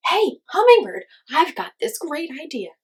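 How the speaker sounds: high, loud eager call; excited idea. A narrator's reading. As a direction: blurt out a sudden idea. Coyote hits on an idea.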